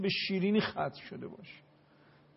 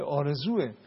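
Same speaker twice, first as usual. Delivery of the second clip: ordinary talk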